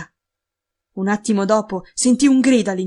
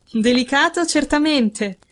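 lightly amused, pitched higher; amused smile; 5 semitones higher, slower than usual. A narrator's reading. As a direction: answer with a touch of amusement. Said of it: "rispose Holmes con un sorriso divertito". (con un sorriso divertito)

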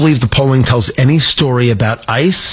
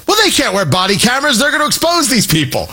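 higher-pitched mimicked protest; mock objection; 8 semitones higher than usual. Host mimicking critics of body cameras, mock objection. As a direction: imitate alarmed critics, pitched up.

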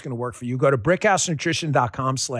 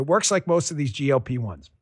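usual speaking voice

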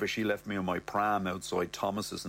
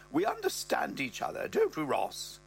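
higher mother voice, rising high at the end; mother's voice; 8 semitones higher than usual. Acted. Mother tells Brett about Ross's libido, in a higher voice. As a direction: voice the mother, pitched up and prim. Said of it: "the old dear's there".

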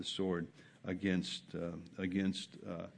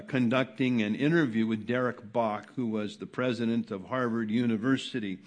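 ordinary delivery

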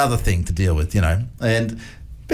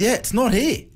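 higher-pitched, mock-worried son on the phone; imitated panic; 11 semitones higher than usual. Son phoning dad about a missing car, imitated panic. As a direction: play a son's mild panic, pitched up. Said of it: "he's panicking. I can hear it in his voice".

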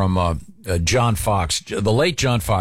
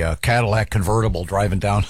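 ordinary delivery

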